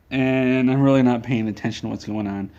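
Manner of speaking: normal talking voice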